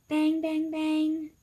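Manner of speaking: the dog knocking at Cupcake's door; knocking sound. Acted. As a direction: bang out each knock.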